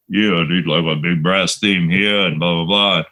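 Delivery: slurred, husky tough-guy impression; Stallone impression. Director relaying the star's music notes, in his imitated voice. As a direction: imitate a famous action star relaying notes. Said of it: "in Stallone's voice".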